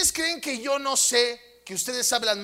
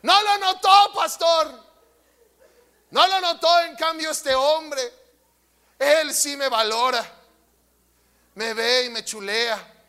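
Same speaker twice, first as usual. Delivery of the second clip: complaining wife; 3 semitones higher, 4 dB louder than usual. Acted. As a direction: play the wife pleading her case to the pastor. (esposa quejosa, más aguda y fuerte al principio y luego se calma)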